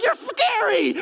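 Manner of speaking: high-pitched mock terror; freaking out. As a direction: squeal it like a frightened shepherd. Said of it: "they're freaking out".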